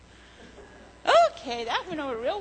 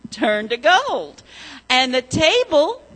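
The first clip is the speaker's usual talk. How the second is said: put-on storytelling to children; 5 dB louder than usual. A narrator's reading. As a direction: tell it like a children's story, leaning on each gold. Children's story told in church.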